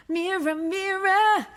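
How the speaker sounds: a hint of Long Island vowels in a sung mirror; crooned Long Island mirror; Long Island accent. Shown as a demonstration. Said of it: "my Long Island comes out a little bit".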